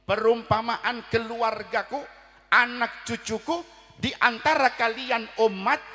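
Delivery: loud, high, slow sermon delivery; preacher driving home the point; fiery preaching. Acted. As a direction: preach it out to the congregation. Preaching at full force.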